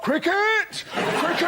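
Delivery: high-pitched hearty shout; mock rallying cry. Telegraph answering the other papers, exclaiming cricket. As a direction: call it out high and gleeful.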